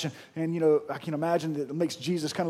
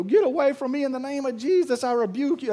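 higher-pitched, hammed-up rebuke of a spider; mock rebuke; 6 semitones higher than usual. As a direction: play at casting out a spider in mild panic. Acting out panicked rebuke at spider.

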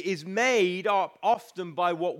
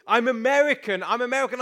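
put-on louder, higher, insisting like a stubborn boy; insistent child; 4 semitones higher, 6 dB louder, faster than usual. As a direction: mimic the son digging in his heels. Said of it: "And he's like".

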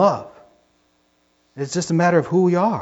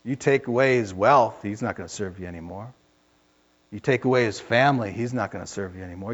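Satan's voice; 7 semitones lower, 8 dB quieter than usual. Acted.

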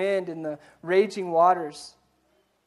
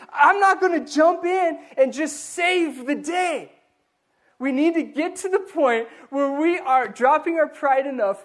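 loud, higher, climbing sermon voice; a pastor rising to the sermon's peak; full-force preaching; 7 semitones higher, 6 dB louder than usual. Acted. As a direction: preach it, building with each line.

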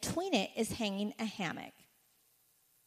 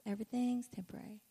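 usual speaking voice

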